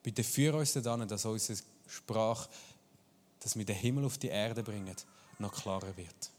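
ordinary talk, nothing marked

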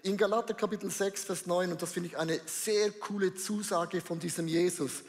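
normal talking voice

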